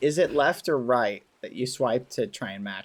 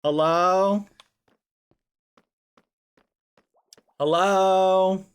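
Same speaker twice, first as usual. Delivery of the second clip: calling out; 6 semitones higher, 6 dB louder, much slower than usual. Acted. Calling into the void.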